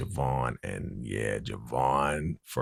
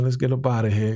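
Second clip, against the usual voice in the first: coaxing Geneva voice; 8 semitones higher, 4 dB louder, faster than usual. Retold or imitated. Geneva pulling him away.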